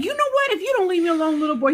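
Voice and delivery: high, mock-stern mama warning; mock mom threat. Mom warning her pestering son, high mock threat. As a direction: warn the little boy, playfully high.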